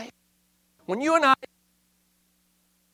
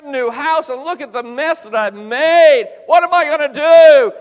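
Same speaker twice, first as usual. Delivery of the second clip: building panic, louder and higher; rising panic; 6 semitones higher, 7 dB louder than usual. Retold or imitated. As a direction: fret more and more over the mess. Panics over the mess.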